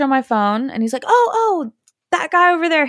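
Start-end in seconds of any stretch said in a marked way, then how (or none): 1.1-2.9 sudden recognition. Retold or imitated. Neighbour suddenly remembers.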